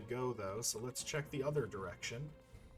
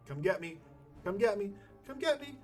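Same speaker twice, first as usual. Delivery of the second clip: rising higher with each call, louder; taunting; 9 semitones higher, 5 dB louder than usual. Pretend. Player daring monsters to come get him, taunting. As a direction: goad them in a climbing, sing-song dare.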